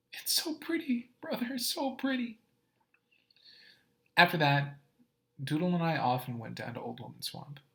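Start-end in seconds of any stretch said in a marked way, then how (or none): 0.1-2.4 put-on high, hushed little-boy whisper; Doodle's voice. Little brother in awe of the swamp, high hushed whisper. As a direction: breathe it in wonder as a small boy.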